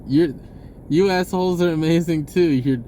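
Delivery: ordinary talk, nothing marked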